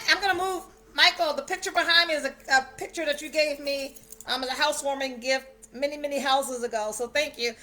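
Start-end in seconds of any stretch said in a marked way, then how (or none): none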